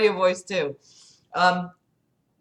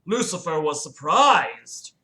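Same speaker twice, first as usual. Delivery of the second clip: ordinary delivery, nothing marked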